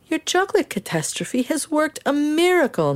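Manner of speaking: put-on beaming with delight at the boy; delighted grandpa.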